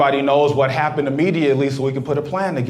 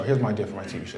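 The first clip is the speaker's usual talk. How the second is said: conspiratorial hush; 9 semitones lower, 10 dB quieter, much faster than usual. Acted. Repeat said hushed.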